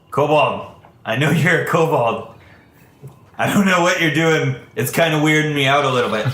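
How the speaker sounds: suspicious guard. Acted. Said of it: "he says".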